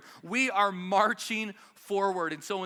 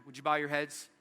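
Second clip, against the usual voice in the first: normal talking voice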